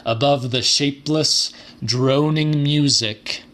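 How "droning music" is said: plain reading voice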